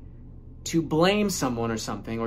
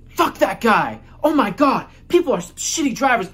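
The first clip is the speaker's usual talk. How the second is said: loud, high furious rant at another driver; mock road rage; 8 semitones higher, 7 dB louder than usual. Venting at a driver who cut you off in traffic, furious shout. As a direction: blow up like someone just cut you off. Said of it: "you go".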